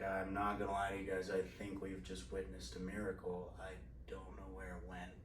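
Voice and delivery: very soft, low-key confiding voice; imitated hushed doctor. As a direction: lightly voice a doctor speaking under his breath. Retold cardiologist confiding about a miracle.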